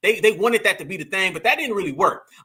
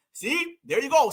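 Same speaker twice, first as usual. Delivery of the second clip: ordinary talk, nothing marked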